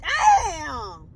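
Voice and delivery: ordinary talk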